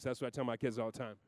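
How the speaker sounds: ordinary talk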